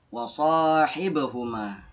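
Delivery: "Wa sahibahuma" with a short "e" after b; bouncing b. Shown as a demonstration. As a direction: draw out "sahi", then bounce the b in "Wa sahibahuma". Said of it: "Dia memantul".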